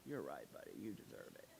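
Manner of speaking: soft, low fatherly comfort; tender reassurance. Retold or imitated. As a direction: reassure your son gently. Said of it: "And I'm like".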